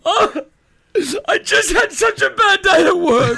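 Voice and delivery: high-pitched, hammed-up man in tears; mock crying. Mimicking a boyfriend in tears over work, mock crying. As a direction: wail it in a badly faked sob. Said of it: "he's crying".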